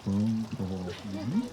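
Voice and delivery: normal talking voice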